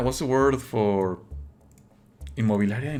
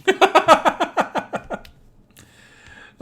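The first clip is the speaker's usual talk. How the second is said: high laugh; 3 dB louder than usual. Real, not acted. Reacting to Eliza's reply.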